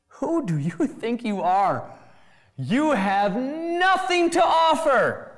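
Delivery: from stern question to ringing cry; building to a shout. Acted. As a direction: hurl it at the proud.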